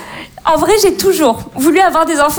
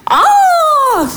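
high, long falling "oh"; impressed gasp; 9 semitones higher than usual. Acted. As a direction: let out an exaggerated, impressed oh. Rival mum hears Pope is involved.

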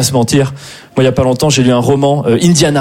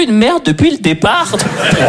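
dismayed realisation; 4 semitones higher, slower than usual. Acted. Horrified imagined self-discovery.

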